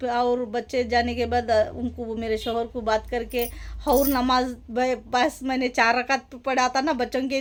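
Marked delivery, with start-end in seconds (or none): none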